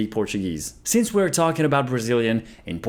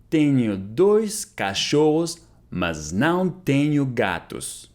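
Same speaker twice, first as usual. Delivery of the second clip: very slow, drawn out, clearly spaced; slow model repeat; much slower than usual. Shown as a demonstration. Teacher repeating Portuguese for students, very slowly. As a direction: say it very slowly for learners to copy. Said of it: "slow repeat of model".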